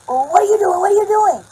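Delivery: loud, high-pitched mock yell; mimicked shouting. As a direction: act out a heated shouting match. Acted shouting match with the stranger.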